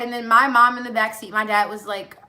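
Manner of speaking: ordinary talk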